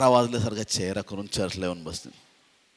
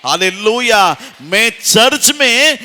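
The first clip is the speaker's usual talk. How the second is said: high, loud, building to a roaring shout; impassioned revival-preacher crescendo; full-force preaching; 19 dB louder than usual. Real, not acted. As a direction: fire up the congregation with shouted declarations.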